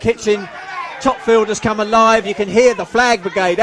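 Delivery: usual speaking voice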